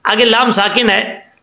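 ordinary talk, nothing marked

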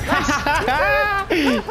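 loud, high victory laugh; joyful laugh. Real, not acted. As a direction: burst out laughing at the win. Winning the round.

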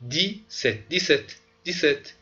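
دی ست said in two parts, without the p; French dix-sept. Shown as a demonstration. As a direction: sound out دی ست, high and clear. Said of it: "نگید سپت، بگید ست".

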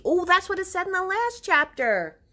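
high, loud and bright; suddenly remember with delight; excited realization. Real, not acted.

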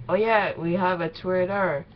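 ordinary delivery

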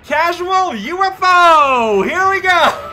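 high, loud, stretched-out yell; excited whooping shout. Real, not acted. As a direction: holler it like a launch cry. Whooping as UFO takes off.

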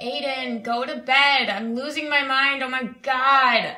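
put-on loud, fed-up shout at the kid; exasperated yelling. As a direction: yell at the toddler who won't sleep. Yelling at toddler at bedtime.

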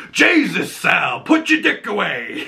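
high exasperated gangster; Penguin voice. Retold or imitated. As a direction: scoff like a weary mob boss. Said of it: "the penguin just goes".

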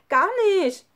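high, loud indignant denial; shouted protest. Acted. Nele and Lukas denying together, shouting loudly. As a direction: yell it back in protest. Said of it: "riefen Nele und Lukas gleichzeitig".

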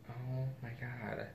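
very soft, slowed-down amazement; hushed awe. Real, not acted. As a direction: breathe it out slowly, overcome. Overwhelmed by the cute detail.

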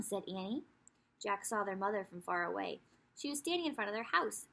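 plain reading voice

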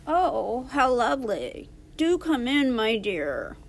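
croaked fake-granny voice; croaky wolf. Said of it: "croaked the wolf".

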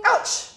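high-pitched pretend yelp; mock ouch. Teacher pretending the pencil pricks, high mock yelp. Playful cry at sharp point.

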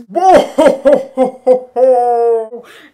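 loud, long ho-ho-ho; booming Santa laugh. Acted. Santa arriving at the dungeon, booming laugh. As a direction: let out a big booming laugh. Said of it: "this big booming voice".

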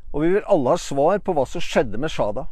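ordinary talk

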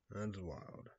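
murmured That's wild; quiet echo. Real, not acted. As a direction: let it fade under the breath. Repeated reaction, softer echo.

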